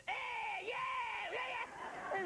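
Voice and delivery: lazy high shouted yeahs; mock American kid. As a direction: play a bored American kid. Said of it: "an American kid would have been like".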